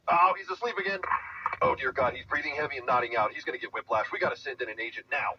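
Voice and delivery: alarmed radio call. Pretend.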